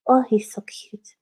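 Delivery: slow, breathless whisper over the cat; whispered coo. Real, not acted. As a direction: whisper how cute he is, slowly.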